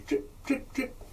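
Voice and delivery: soft, high little drip sounds; imitated dripping. Assistant drips medicine from pipette, tiny imitated drips. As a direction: voice each drop falling, quietly.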